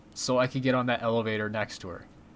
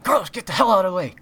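much higher, louder, fast yell; pretend shout; 9 semitones higher, 9 dB louder, faster than usual. Imagining shoving Carlos aside to reach the girl, loud pretend yell. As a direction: bark it like you're barging past.

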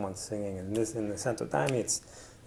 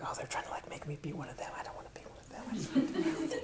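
barely audible inner fear; anxious whisper; 8 semitones higher, 11 dB quieter, faster than usual. Retold or imitated. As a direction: whisper it, torn and scared. Inner fearful thoughts whispered.

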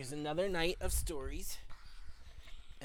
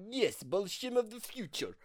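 higher-pitched mock Russian accent; 5 semitones higher than usual. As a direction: answer as Alexei in a Russian accent. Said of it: "do the accent for Alexei".

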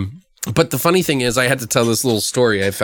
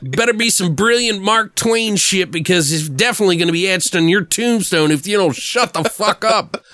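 high-pitched movie-villain threat, amused; Otis; 7 semitones higher than usual. Retold or imitated. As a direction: deliver the cool threat with relish, pitched up. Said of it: "Otis says that cool line".

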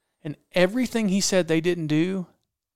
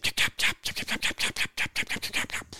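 rapid voiceless clucks; imitated hammered turkey call; 5 dB quieter than usual. Hunter hammering the gobbler on his call, imitated rapid clucks. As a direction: click out sharp, rapid turkey cutts. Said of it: "Hammer him".

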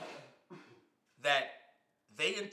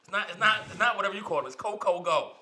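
louder, insistent correction; 5 semitones higher, 8 dB louder than usual. Real, not acted. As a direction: insist on the name, loud. Insisting on own nickname.

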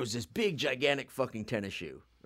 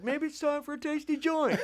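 much higher, goofy put-on voice; mock stoned voice. Telling a made-up ski trip story, goofy high voice. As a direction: play the high skier on the lift. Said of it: "And then I'm like".

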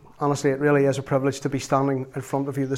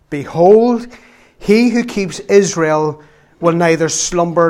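slow, emphatic Bible reading; scripture reading; 5 dB louder, slower than usual. Acted. Pastor reading Psalm one hundred and twenty-one to the church, solemn scripture reading. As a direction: read the Psalm out slowly, with weight on each phrase.

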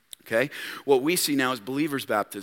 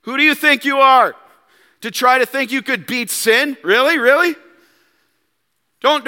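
loud, higher, mocking challenge; taunting devil voice; 7 semitones higher, 6 dB louder than usual. Satan taunting the believer, loud mocking sneer. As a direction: sneer it like the accuser. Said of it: "Same voice".

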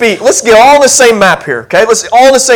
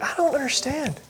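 much softer, confused murmur; puzzled; 6 semitones higher, 17 dB quieter than usual. Retold or imitated. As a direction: quietly give up on the verse. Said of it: "you're just like".

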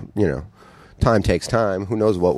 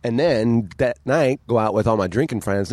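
ordinary talk

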